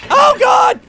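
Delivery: sudden high shriek; fearful scream. Real, not acted. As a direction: shriek in shock. Jump scare.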